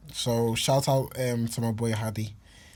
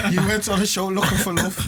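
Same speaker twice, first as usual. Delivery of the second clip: talking through laughter; laughing; 8 semitones higher, 3 dB louder than usual. Real, not acted. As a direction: laugh while telling it. Laughing at his friend's story.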